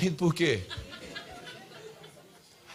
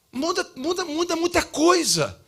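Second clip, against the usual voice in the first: each muda pressed louder and slower; emphatic repetition; 12 semitones higher, 5 dB louder than usual. Real, not acted. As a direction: hammer the word muda home. Preacher stressing change.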